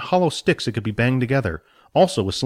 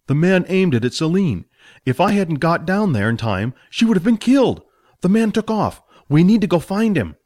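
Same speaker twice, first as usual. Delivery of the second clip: put-on urgent young hero; 7 semitones higher, 3 dB louder than usual. Billy urges pursuit.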